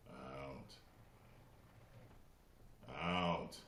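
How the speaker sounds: lightly voiced kung fu dub, calm and stern; a hint of an old kung fu dub, cold and measured; Bruce Lee dub impression. Streamer does Bruce Lee dub line for chat, restrained threat. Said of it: "I'm gonna do my impression of Bruce Lee".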